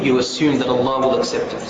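ordinary talk, nothing marked

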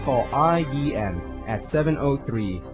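usual speaking voice

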